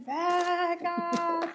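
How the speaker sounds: mild, drawn-out imitation of a yell; mock scream. Friend imitates her hurt pal in the grass, mock screaming. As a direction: mimic a far-off cry without full volume. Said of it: "and she's screaming".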